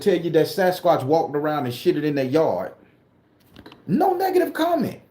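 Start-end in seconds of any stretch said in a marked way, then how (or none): 3.9-5.0 incredulous. Real, not acted. Indignant about comments.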